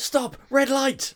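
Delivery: high, loud urgent stop call; sudden shout. Retold or imitated. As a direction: snap them to a halt. Gracie halts the fleeing group.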